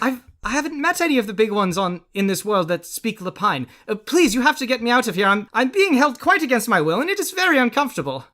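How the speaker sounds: high, hurried little rabbit voice; flustered talking bunny begging for rescue; pleading rabbit. Acted. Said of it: "this rabbit looks surprised".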